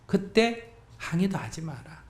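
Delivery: ordinary talk, nothing marked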